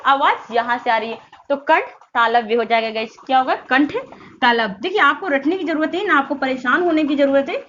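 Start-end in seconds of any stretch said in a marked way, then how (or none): none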